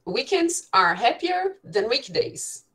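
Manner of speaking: teacher spelling out an example for the class, slowly; slow model sentence. Shown as a demonstration. Teacher reads the example to students, slow and high. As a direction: read out slowly, pitched well up. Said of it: "vamos dizer assim".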